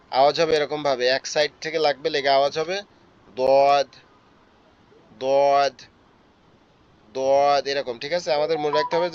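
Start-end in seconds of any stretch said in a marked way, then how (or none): 3.4-5.7 দদ said heavy and full, from the tongue root; heavy thick dod. Shown as a demonstration. ভারী মোটা দদ. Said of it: "এটা ভারী এবং মোটা আওয়াজ হবে".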